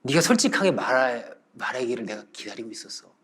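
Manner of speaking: quiet elder confiding; kindly master. Retold or imitated. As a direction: gently reveal it in a soft low voice. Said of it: "이렇게 얘기를 하는 거예요".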